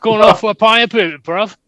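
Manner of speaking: a cocky English football lad asking you to the pub; English soccer hooligan calling for a pint. Pretend. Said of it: "soccer hooligan".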